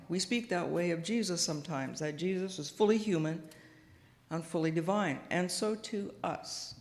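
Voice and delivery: normal talking voice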